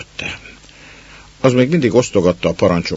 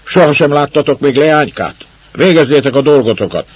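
scolding shout; 8 semitones higher, 8 dB louder than usual. Acted. Baroness scolds the servants.

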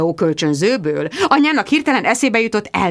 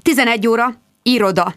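plain reading voice

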